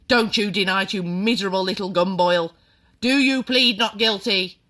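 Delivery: bully him into confessing; furious headmistress. A narrator's reading. Trunchbull interrogating the boy.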